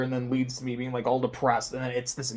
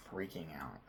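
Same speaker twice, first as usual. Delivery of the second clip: whispered panic; 7 semitones lower, 17 dB quieter than usual. Real, not acted. Stressing about lost key.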